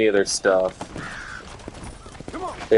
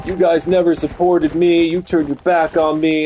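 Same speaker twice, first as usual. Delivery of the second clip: higher and louder, mocking a wrestler's complaint; mock whine; 7 semitones higher, 4 dB louder than usual. Streamer mocking a wrestler's heel promo with a whiny voice. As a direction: whine like a sulky wrestler, hammed-up.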